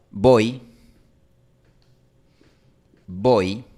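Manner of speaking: "voy" fuerte, con oi cerrando en i alta; palabra española. Shown as a demonstration. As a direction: di "voy" bien claro, a la española. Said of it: "en español tenemos la palabra". ("voy" español marcado)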